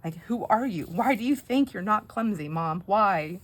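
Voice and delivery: mock exasperated questioning; exasperated child, imitated. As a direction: scold mom like an aghast kid. Son reproaching clumsy mom, retold.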